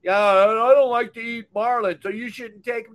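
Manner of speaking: light mock of a critic; mocking a commenter. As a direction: parody the know-it-all commenter. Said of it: "when I did that marlin video".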